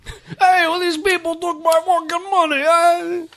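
hammed-up East Coast accent. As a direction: complain like a cheated Jersey gambler. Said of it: "everybody from the East Coast sounds".

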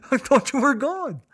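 high, wailing sob; crying. Retold or imitated. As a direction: sob out "I thought you were gone" in a high wail. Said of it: "So I cried".

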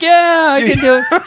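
high, bright mock-eager squeak; eager yes. Retelling his yes to the ad people in a high mock-eager voice.

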